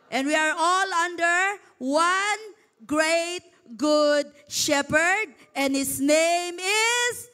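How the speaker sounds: slow rousing pulpit crescendo; preaching build-up. Acted. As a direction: hold the room before the reveal.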